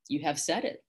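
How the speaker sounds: plain reading voice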